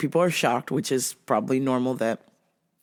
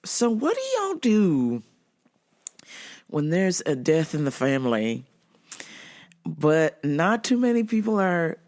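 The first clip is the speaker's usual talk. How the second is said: ordinary talk, nothing marked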